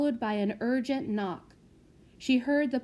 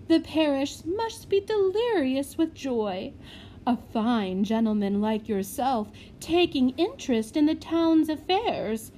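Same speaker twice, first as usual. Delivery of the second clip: fawning matron; 5 semitones higher, 5 dB louder than usual. Acted. Matron gushing to deflect.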